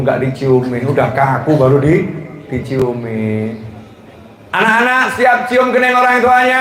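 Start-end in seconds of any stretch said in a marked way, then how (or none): 4.5-6.6 high, sustained call to a crowd; ringing preacher's rallying question. Real, not acted. Rallying the children for a response.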